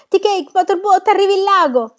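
loud, high-pitched warning cry; shouted alarm. A narrator's reading. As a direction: scream to wake your sleeping friends. Waking companions as flood arrives. (grido acuto d'allarme)

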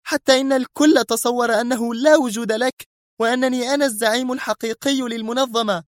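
high, sustained indignant tirade; Sonia crying out. Acted. Sonia rails at Mr X over his hiding, high and indignant. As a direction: pour out years of grievance. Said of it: "صرخت".

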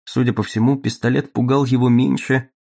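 ordinary talk

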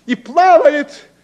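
slow, high, loud theatrical line; hammy stage actor. Shown as a demonstration. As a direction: declaim it like an old tragedian. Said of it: "Последние мои слова".

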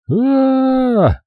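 put-on high piercing child scream; boy's terrified scream. A narrator's reading. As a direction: scream in sheer terror. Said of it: "снова заорал Билли".

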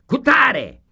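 high, loud name called out; roll call shout. Acted. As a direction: call the name across the room.

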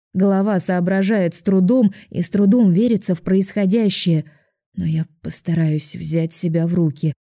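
ordinary delivery, nothing marked

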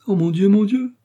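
high, loud gasp of dread; terrified. A narrator's reading. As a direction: cry out in fright, voice leaping up. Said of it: "murmura la reine, dont les dents claquaient d'effroi". (effroi, voix aiguë et forte)

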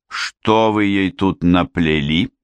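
plain reading voice

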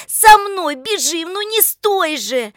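high, loud, drawn-out urging; urgent command. A narrator's reading. Lika hurrying Oleg after her, urgent high call. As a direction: urge him on in a high cry.

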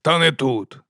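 barked. A narrator's reading. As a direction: snap it with a touch of authority. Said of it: "гаркнув верховний гросмейстер".

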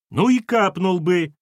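high, loud, slow yell; yelling back. A narrator's reading. Ex-wife yelling back at her ex-husband over a lock. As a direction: shout right back at him. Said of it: "тоже заорала Кира".

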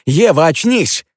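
loud, high, slow pleading call; urgent call. Acted. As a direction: shake her awake with your voice. Shaking her to wake.

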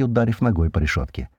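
ordinary delivery, nothing marked

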